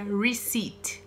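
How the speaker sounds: receipt said as ree-SEET, slowly and softly; receipt with no P, drawn out; soft slow model. Shown as a demonstration. Teacher repeats the word gently for students, receipt as ree-SEET. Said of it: "Não pronuncia esse P aí".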